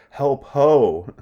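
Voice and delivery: loud, high alarmed cry from behind the curtain; Polonius crying for help. Acted. Teacher voicing Polonius crying out for help. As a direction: call out in alarm.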